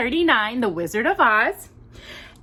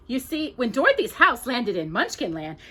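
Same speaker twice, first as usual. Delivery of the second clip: plain reading voice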